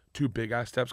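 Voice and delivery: ordinary talk, nothing marked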